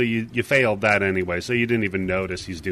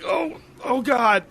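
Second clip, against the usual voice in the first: high, drawn-out cry of alarm; alarmed old man; 13 semitones higher, slower than usual. Acted. Old man jolted by the carriage hitting a rock, alarmed. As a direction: groan it out high and slow, in distress. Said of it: "but he's like".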